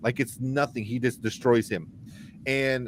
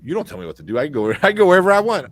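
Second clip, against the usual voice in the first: rising to a louder, higher, fast boast; 3 semitones higher, 7 dB louder, faster than usual. Retold or imitated. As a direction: brag like an untouchable hero. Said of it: "And he's like".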